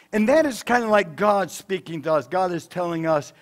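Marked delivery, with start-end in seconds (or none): none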